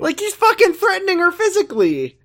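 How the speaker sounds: high, loud outrage; shocked, indignant outburst; outraged. Real, not acted. Outraged at Ricky.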